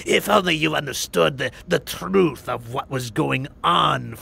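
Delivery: slow, smug villain voice; mustache twirling villain. Retold or imitated. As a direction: lecture them like a smug zealot. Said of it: "mustache-twirling villain".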